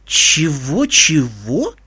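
puzzled, each word lifting at the end; baffled question. Acted. Pony hears an unknown Elvish phrase, baffled double question. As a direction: ask it as if you understood nothing.

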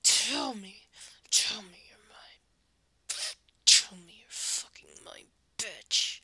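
loud, urgent, insistent order; forceful breathy demand. Pretend. Dominant demanding a pledge, loud breathy insistence. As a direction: punch each "tell" hard.

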